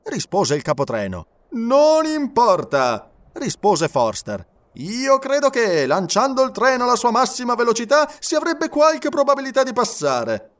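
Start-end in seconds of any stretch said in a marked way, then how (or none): 1.5-3.1 high, loud, drawn-out brush-off; emphatic dismissal. A narrator's reading. Engineer dismissing the conductor's warning, emphatic. 4.7-10.5 high-pitched, projected character voice; confident engineer. A narrator's reading. Engineer pitching his plan to passengers, confident and emphatic.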